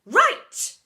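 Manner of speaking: high, loud call; announcing. A narrator's reading. Deputy seizing control, loud and high. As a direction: bark for control. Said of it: "announced Seeth".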